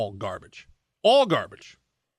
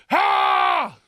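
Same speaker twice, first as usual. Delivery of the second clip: short loud hammed-up scream; mock scream; 5 dB louder than usual. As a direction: let out a cartoonish yell. Mock scream after warmonger bit.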